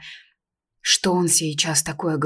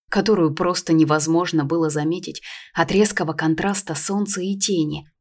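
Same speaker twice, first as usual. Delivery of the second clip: usual speaking voice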